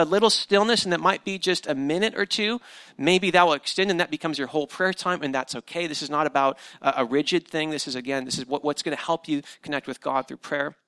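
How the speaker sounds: usual speaking voice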